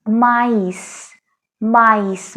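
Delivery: माइस slow, s clearly sounded; French pronunciation. Shown as a demonstration. Teacher models French maïs, sounding the s. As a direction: drag out माइस with the final s. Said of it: "अब इसको हम बोलते हैं माइस".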